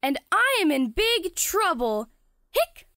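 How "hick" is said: sharp high hiccup. Acted. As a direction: squeak out a hiccup. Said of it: "Jamie has the hiccups".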